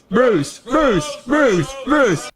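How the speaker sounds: high shrill-pitched scream of the name Bruce; mock scream calling Bruce. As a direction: yell it like a sitcom boss losing it. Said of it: "when he screams, "Bruce".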